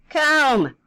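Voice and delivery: high, loud one-word order; mom yelling out. Acted. Mom yells a single command at child, loud. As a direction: call it out loudly, pitched high. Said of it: "if Mom just yells out".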